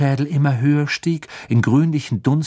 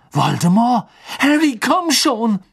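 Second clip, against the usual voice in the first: high, urgent cry, drawn out; frightened, insistent call; panicked urging; 10 semitones higher, 5 dB louder than usual. A narrator's reading. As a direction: urge him to flee. Urging Harry to flee.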